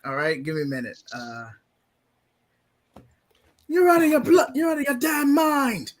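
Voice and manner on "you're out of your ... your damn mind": high, furious British shout. Pretend. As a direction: rage at the villain, throat wide open.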